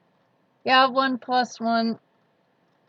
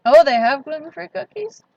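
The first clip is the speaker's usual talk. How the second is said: high, loud surprised question; 6 semitones higher, 6 dB louder than usual. Real, not acted. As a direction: genuinely caught off guard. Reading a surprising chat comment.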